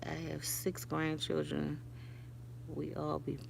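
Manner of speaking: very soft, slow hushed voice; barely above a breath; whispering. Retold or imitated. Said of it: "My daughter is whispering".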